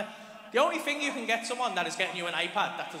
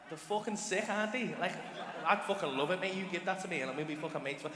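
ordinary delivery

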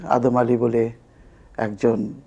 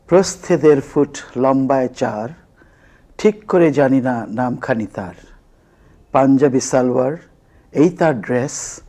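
measured, rhythmic verse recital; comic poem recitation; 2 semitones higher, 4 dB louder than usual. Acted. Former student reciting their teasing poem about a campus girl, comic recitation. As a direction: recite it like comic rhyming verse, leaning on the beats.

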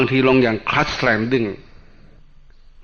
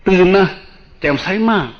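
bright excited; 7 semitones higher, 3 dB louder than usual. Acted. Monk describing alert bright state.

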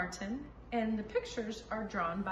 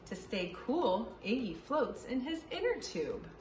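put-on reading a picture book aloud to kids; story reading. Teacher reading a picture book to children, lively storytime. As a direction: read it out brightly for young listeners.

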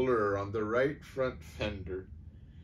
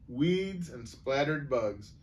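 plain reading voice

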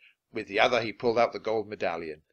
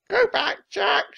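very high, loud bird warning; person-like parrot; 7 dB louder, slower than usual. A narrator's reading. Parrot warns Jack like a person, high-voiced. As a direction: squawk it with a hint of human speech. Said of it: "She sounded like a person".

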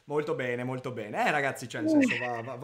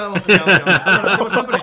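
louder laughing push on; 10 dB louder, faster than usual. Real, not acted. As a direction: press on through laughter. Laughing loud.

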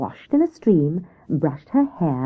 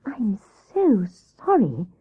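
slow, humble apology; 3 semitones higher, much slower than usual. Acted. Grey Rabbit apologises to cross Hare, humble. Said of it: "said Grey Rabbit humbly".

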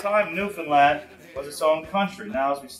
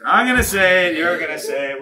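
raised voice, higher at the start; loud instruction; 4 semitones higher, 7 dB louder than usual. Real, not acted. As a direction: call the drill out to the group. Calling instructions to the group.

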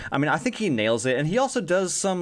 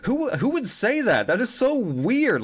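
incredulous; 7 semitones higher, slower than usual. Retold or imitated. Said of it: "And I'm like".

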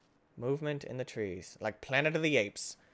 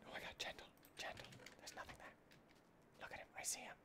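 hushed, breathy whisper; 22 dB quieter, faster than usual. Real, not acted. Player hiding from an enemy, in a whisper. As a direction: keep it down so he can't hear.

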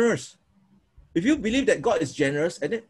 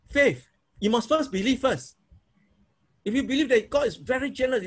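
normal talking voice

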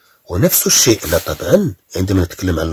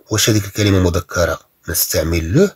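ordinary talk, nothing marked

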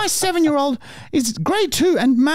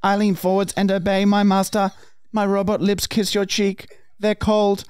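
toy robot voice; 8 semitones lower than usual. Acted. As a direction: read it like a stiff toy robot. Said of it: "I am a robot".

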